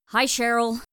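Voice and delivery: high, loud greeting; calling out. Acted. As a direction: holler a hello across the lawn. Shouted greeting across the lawn.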